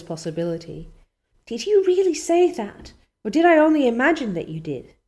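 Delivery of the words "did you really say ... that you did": excited. A narrator's reading. Anne pleading excitedly.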